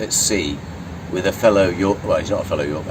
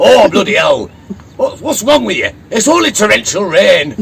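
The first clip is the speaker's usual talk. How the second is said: loud, high mock-exasperated Yorkshire voice; Yorkshireman banter; 12 semitones higher, 9 dB louder than usual. Sailors bantering on a wet night watch, loud Yorkshire voice. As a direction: bellow it as a scoffing Yorkshireman. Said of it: "slipping into voices like this".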